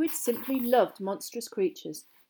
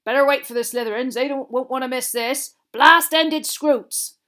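Hagrid, eager and proud; 4 semitones higher, 10 dB louder than usual. A narrator's reading. Hagrid presenting his creatures to the class, loud and eager. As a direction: announce the creatures at full voice, slowing on the name.

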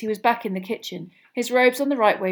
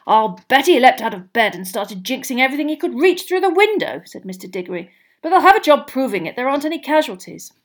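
Mr Diggory; 4 semitones higher, 7 dB louder than usual. A narrator's reading. Diggory voiced.

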